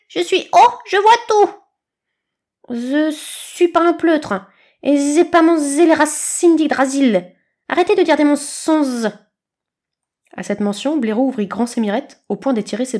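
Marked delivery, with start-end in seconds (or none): 0.1-0.7 high, loud, slow bragging eagle voice; proud little eagle. A narrator's reading. Eagle boasting he sees everything, high and slow. 0.9-9.1 high, loud, slow bragging eagle voice; proud little eagle. A narrator's reading. Eagle boasting he sees everything, high and slow. Aiglon keeps arguing, showing off.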